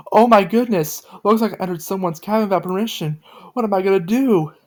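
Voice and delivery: high, loud panicked cry of worry; worried. A narrator's reading. Joe realizing she entered a stranger's cabin, high and worried. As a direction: gasp it out, scared you've trespassed. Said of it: "Joe said in a worried manner".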